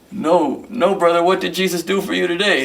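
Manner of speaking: mild mock panic, copying the churchy cadence; nervous mimicry. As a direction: parrot the question, flustered. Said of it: "and I go".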